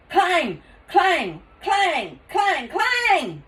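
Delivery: high, loud clanging bell; alarm bell clang. Acted. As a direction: ring it like a bell. Fire alarm imitated.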